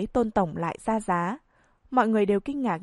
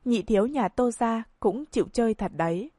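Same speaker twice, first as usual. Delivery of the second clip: usual speaking voice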